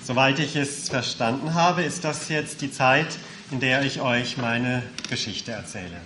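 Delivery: ordinary talk, nothing marked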